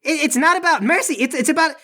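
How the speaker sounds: high-pitched, stammering protest; flustered guard. Acted. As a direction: stumble over words in agitation.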